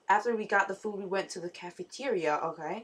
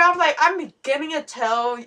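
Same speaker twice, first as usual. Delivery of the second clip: ordinary delivery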